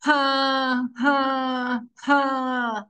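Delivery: three sustained, level "haa" moans; imitated moans, held a little high; demonstrated moaning. Shown as a demonstration. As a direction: moan in separate, drawn-out breaths. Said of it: "supposed to be sounding like moaning".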